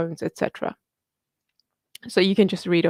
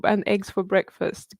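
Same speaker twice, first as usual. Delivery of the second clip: usual speaking voice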